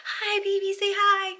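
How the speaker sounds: high, soft coo to a little one. Real, not acted. Aside to a baby.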